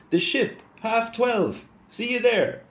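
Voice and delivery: very high-pitched distant call; thin, high mock shout over a distance; shouting back. A narrator's reading. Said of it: "shouted back to Stephen".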